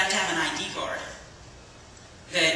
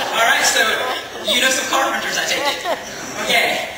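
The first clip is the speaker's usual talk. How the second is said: louder, higher, still chuckling; laughing; 6 semitones higher, 9 dB louder than usual. Real, not acted. Preacher teasing the congregation, laughing. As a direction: tease them through the laugh.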